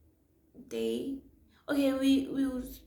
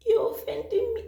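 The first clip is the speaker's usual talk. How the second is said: high, louder tearful protest; mock crying; 12 semitones higher, 6 dB louder than usual. Crying woman protests to the man, mock tearful wail. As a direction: wail it as a retold complaint. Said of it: "So I'm crying".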